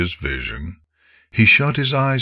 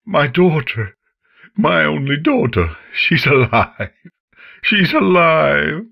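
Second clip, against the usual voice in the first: higher, louder cries of relief; joyous cries; 9 semitones higher, 4 dB louder than usual. Acted. Father hugging his daughter, crying out loud with joy. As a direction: cry it out with joy.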